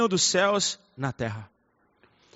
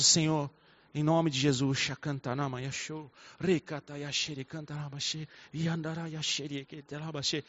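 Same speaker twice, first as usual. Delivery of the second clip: hushed, murmured tongues prayer, much softer; 5 semitones lower, 10 dB quieter than usual. Shown as a demonstration. Pastor demonstrating gentle prayer in tongues, hushed. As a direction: pray in tongues quietly, almost to yourself.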